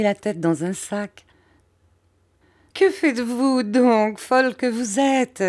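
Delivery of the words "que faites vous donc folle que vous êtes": laughing. A narrator's reading. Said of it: "dit ma mère en riant".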